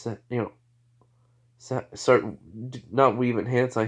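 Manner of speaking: plain reading voice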